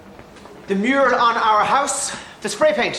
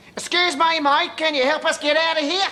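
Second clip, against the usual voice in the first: higher-pitched Australian tourist voice; hammed-up Australian tourist; Australian accent; 5 semitones higher than usual. Shown as a demonstration. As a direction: put on an Australian accent to ask for help. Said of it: "I was being an Australian tourist".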